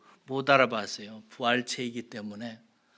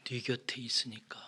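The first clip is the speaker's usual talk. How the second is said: whisper; 10 dB quieter than usual. Acted. Preacher's hushed answer.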